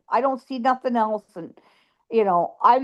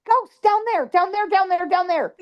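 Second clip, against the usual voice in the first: high, urgent repeated cries; acted panic; 7 semitones higher than usual. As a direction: frantically point dad to the danger. Retold panic telling dad.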